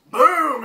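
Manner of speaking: sudden loud, high boom; imitated explosion. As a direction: burst out with a big explosive boom.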